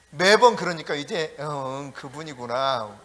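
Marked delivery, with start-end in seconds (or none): 1.4-3.0 quiet realization. Retold or imitated. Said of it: "이러는 게".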